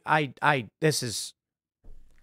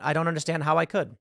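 ordinary talk

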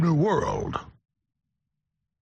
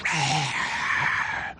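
mock battle roar; 3 semitones lower, 3 dB quieter than usual. Imitating attackers hitting the beach.

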